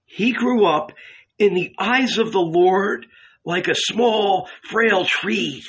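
Matthew proclaims Isaiah to steady their hearts; strong prophetic voice. Acted. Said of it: "full of bitterness and strength".

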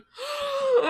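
high, sharp re-enacted gasp; big high intake of breath; shocked gasp. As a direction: gasp in mock shock at the screen. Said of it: "I paused the movie, was like".

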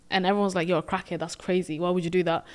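ordinary talk

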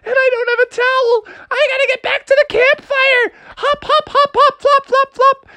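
high, panicky freezing cry; frantic squeal. Acted. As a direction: squeal from the icy water. Froggy freezing in the river.

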